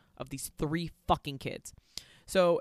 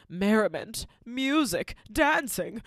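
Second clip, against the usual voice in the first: high, loud sing-song announcing; theatrical delight; 4 semitones higher, 6 dB louder, slower than usual. Retold or imitated. Witch sensing the party mood, grand theatrical delight. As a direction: ham it up like a diva. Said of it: "she kind of, like, intuits".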